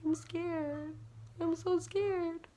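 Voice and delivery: tiny, high, soft scared voice; retold fear. Daughter held by her mom, small frightened voice. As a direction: whimper it small and high, stretching the end. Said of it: "overwhelming feeling of fear".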